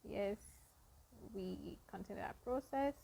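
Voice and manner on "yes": ordinary talk, nothing marked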